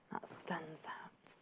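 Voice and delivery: hushed and slow, struck by the print; a slow, awed whisper. Real, not acted. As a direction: breathe it out slowly in a whisper. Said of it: "after a gasp, awed".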